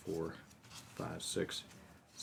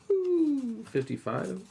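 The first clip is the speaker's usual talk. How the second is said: surprised; 5 dB louder, slower than usual. Real, not acted. Count lands on fifty-five.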